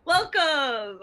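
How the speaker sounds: cheerful host greeting the guest; excited welcome. Real, not acted. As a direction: throw the welcome out with delight. Host's big welcome to guest.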